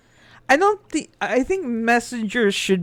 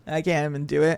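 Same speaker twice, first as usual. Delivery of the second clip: ordinary talk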